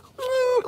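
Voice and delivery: put-on squeaky high whimper of a baby whale; whale cry. Describing the baby whale calling out, a high squeaky hum. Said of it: "comes up and he's like".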